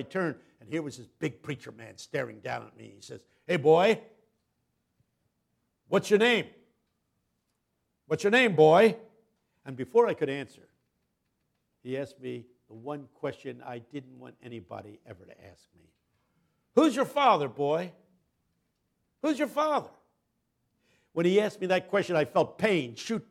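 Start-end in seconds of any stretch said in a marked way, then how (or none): 3.5-9.0 loud, higher, commanding call; booming preacher. Retold or imitated. Big preacher stops the boy after service, booming. 16.8-19.9 loud, higher, commanding call; booming preacher. Retold or imitated. Big preacher stops the boy after service, booming.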